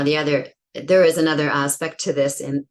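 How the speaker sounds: ordinary talk, nothing marked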